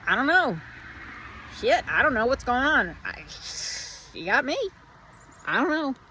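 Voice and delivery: high, loud comic admission of cluelessness; lighthearted, humorous. Acted. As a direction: throw it up lightly, pitched high. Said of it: "it's lighthearted, it's humorous".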